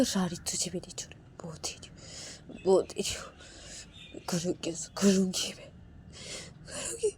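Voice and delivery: a wife whispering threats; whispered threat. Acted.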